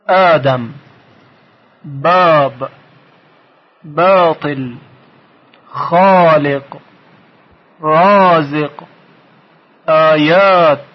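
each example word alone, its "ا" vowel held long and full; long open aa. Shown as a demonstration. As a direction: draw out the long aa in "آدم", "باب", "خالق". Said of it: "صدای کشیدهٔ بالا وجود داره". (آموزشی، با الفِ کشیده)

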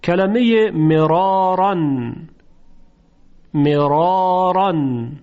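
مرارن very slow, both ra thick and drawn; heavy ra recitation. Shown as a demonstration. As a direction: stretch مرارن with heavy open ra. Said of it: "در اینجا هم حرف را تفخیم". (مرارن آهسته با رای درشت و کشیده)

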